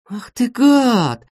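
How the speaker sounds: high, long drawn-out cry of outrage; acted outrage, stretched out; indignant exclamation. A narrator's reading. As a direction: exclaim it high and slow, stung. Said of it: "воскликнула Светка".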